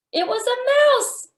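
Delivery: high, bright reveal of the mouse; gasp, then announce it with delight; high excited storytime reveal. Real, not acted.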